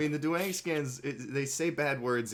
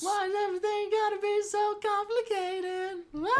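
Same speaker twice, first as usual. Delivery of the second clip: much higher, squeaky meme voice; silly high quote voice. Retold or imitated. Friend quoting a manga line in a squeaky voice. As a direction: squeak it out like a cartoon.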